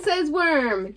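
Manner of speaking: ordinary delivery, nothing marked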